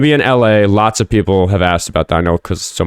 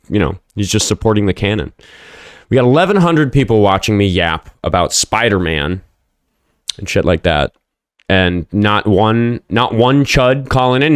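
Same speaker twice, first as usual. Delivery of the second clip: normal talking voice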